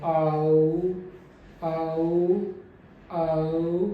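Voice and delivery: आओ gliding from open to closed mouth; closing diphthong demo. Shown as a demonstration. Teacher shows the closing mouth on आओ. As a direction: close the jaw through आओ. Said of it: "मेरा mouth आप देख पा रहे हैं".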